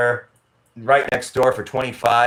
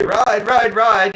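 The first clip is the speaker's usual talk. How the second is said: trike drivers calling; 9 semitones higher, 6 dB louder, much slower than usual. Retold or imitated. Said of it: "they'll say".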